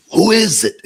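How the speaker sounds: annoyed homeowner calling out; shout. Retold or imitated. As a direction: yell it toward the gate. Yelling at gate visitors.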